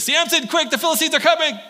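high, loud urgent cry of warning; alarmed shout. Acted. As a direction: shout him awake in mock panic. Delilah wakes Samson in alarm.

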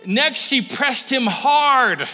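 slow, high, loud dramatic line; emphatic reading. Acted. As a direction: lean hard on every word. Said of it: "look at this".

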